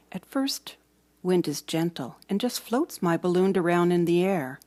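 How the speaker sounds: plain reading voice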